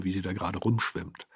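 ordinary talk

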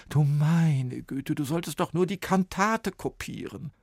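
woman dismayed by Sven's mess; exasperated. A narrator's reading. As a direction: sigh it out in dismay.

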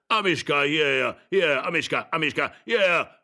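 put-on old man eagerly calling a dog; keen, repeated summons. A narrator's reading. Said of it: "rief der Alte eifrig".

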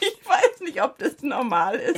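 laughing through the words; giggly and helpless. Real, not acted. Host answering her colleague's question about his nose, laughing.

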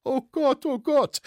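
very high wail; high-pitched lamenting. A narrator's reading. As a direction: moan in despair. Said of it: "jammerte Hetzer wieder".

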